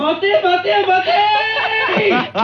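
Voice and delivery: high, drawn-out theatrical yell; dramatic shout. Acted. Guest bursts into the radio booth with a drawn-out yell. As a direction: call out like a stage entrance. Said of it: "お気味の叫びは".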